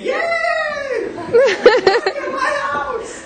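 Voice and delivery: high, loud and slow, bursting with joy; overjoyed little boy. Acted. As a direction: cheer like a kid who just made a friend. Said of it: "And the young boy said".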